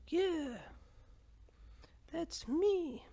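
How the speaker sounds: put-on high, thin old-lady squeak; creaky granny voice well above his own. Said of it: "a feminist in my head sounds like an old lady".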